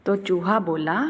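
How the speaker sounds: ordinary delivery, nothing marked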